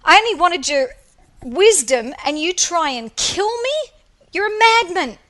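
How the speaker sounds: high, loud, outraged yelling at a madman; the pupil's angry yell at his teacher; furious outburst. Acted. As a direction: blast him for nearly drowning you. Pupil yells at Socrates.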